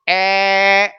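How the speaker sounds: ए held long and level as an open "ai"; wrong vowel demo. Shown as a demonstration. Teacher asking students if it is said so, long-held vowel. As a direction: stretch ए into a steady, drawn-out "aiii". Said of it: "जी नहीं".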